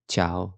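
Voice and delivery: "تشاو" repeated as "chao", no separate I; low, neat "تشاو" with CH straight into the vowel; correct silent I. Shown as a demonstration. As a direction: model "تشاو" without an I glide. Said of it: "هتخليني أقول تش".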